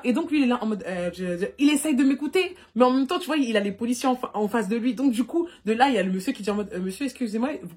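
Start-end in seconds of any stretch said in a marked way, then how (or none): none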